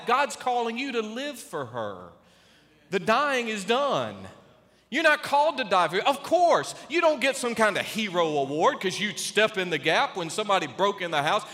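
normal talking voice

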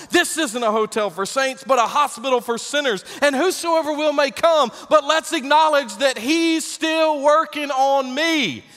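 loud, ringing sermon climb; full-force preaching. Acted. Pastor preaching to the congregation at full force. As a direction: preach it out to the rafters.

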